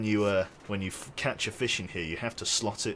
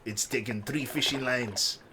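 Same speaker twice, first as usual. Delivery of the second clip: Rasta fish voice. Acted. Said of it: "Rastafarian trout".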